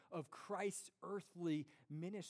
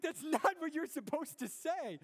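high, loud mock outrage; hammed-up shocked protest; indignant surprise; 9 semitones higher, 7 dB louder than usual. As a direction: play wounded disbelief at the wife. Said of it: "I was like".

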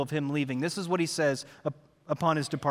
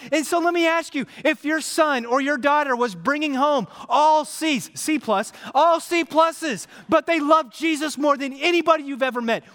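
preaching; 8 semitones higher, 5 dB louder than usual. Acted. Pastor preaching to the congregation, loud and high. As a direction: drive it home to the church.

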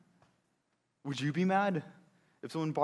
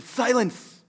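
higher, louder command; commanding silence; 8 semitones higher, 7 dB louder than usual. Acted. Jesus hushing the critics, commanding. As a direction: cut them all off.

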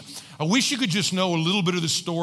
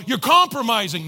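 raised, louder critic's objection; mock accusation; 5 semitones higher, 5 dB louder than usual. Other people objecting to the church's compassion, mock accusing. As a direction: throw it out as an accusation.

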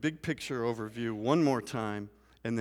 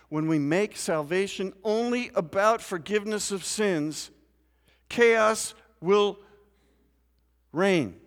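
higher, louder preaching at full force; 9 semitones higher, 8 dB louder than usual. Acted. Pastor warning his congregation about chaos, fervent loud preaching. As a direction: build the sermon to a fervent climax.

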